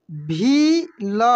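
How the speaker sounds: भील stretched very slowly with a long ई; drawn out. Shown as a demonstration. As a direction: hold the vowel of भील long. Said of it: "कैसे पढ़ेंगे?". (भील को खींचकर बोलिए)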